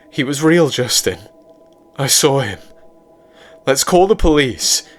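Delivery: put-on insistent scared girlfriend. A narrator's reading. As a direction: urge him to call the police.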